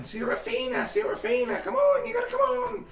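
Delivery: very high, frantic character yell. Acted. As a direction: call urgently through a door, voice climbing. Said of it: "and he's yelling".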